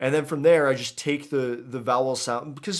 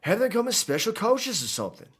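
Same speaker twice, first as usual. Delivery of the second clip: higher, lilting attempt at a Creole accent; animated Creole-accented character, pitched up; Cyrano accent; 9 semitones higher than usual. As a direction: voice a game character in a put-on New Orleans accent. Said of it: "pull out the Cyrano accent".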